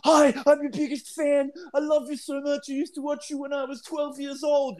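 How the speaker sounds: high, eager fanboy gush; mock an overexcited superfan. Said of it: "he's like".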